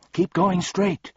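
high-pitched insistent guide; counseling voice. A narrator's reading. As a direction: urge them onward in a high voice. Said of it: "counseled the voice".

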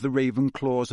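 ordinary delivery, nothing marked

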